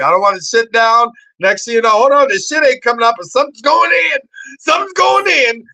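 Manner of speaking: high, loud comic panic rising to a squeal; mock panic. Telling the host about a haunted toilet, mock panicked squeal. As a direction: ham up the terror of the toilet.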